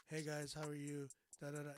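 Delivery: soft, low, even greeting; mock lifeless hello; monotone. Shown as a demonstration. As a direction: drone it with no inflection. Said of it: "it's not just like".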